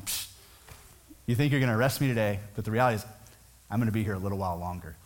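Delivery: cocky, taunting Jesus; arrogant tone. Shown as a demonstration. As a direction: brush them off with a smug "Psh". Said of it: "read this in an arrogant tone of voice".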